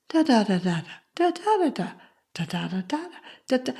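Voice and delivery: wordless "da, da, da" in a soft, swooping lilt; emotional music. Shown as a demonstration. Showing the music in the mother's voice, "da, da, da" lilting tenderly. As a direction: hum out "da, da, da" as the up-and-down tune of comforting speech. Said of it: "But listen to her voice".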